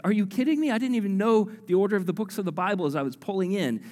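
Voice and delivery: amazed disbelief. Retold or imitated. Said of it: "And I was like".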